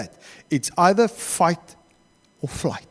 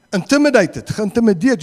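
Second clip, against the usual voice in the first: ordinary talk, nothing marked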